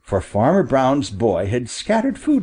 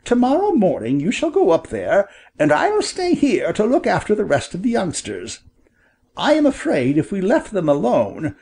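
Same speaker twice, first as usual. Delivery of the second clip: higher storybook quail voice; animated father bird, pitched up; father bird, declaring; 6 semitones higher than usual. Acted. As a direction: lay out the plan in a high bird voice.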